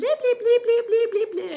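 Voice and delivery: high-pitched mock babble standing in for her own rambling; cartoonish chipmunk babble; high squeaky chatter. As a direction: squeak out nonsense syllables fast and loud to mock your own nonstop talk. Mimics her own rapid chatter.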